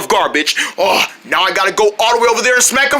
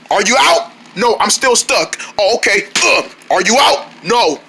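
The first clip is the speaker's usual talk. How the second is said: ordinary talk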